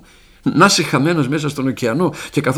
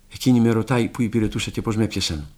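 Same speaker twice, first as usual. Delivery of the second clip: usual speaking voice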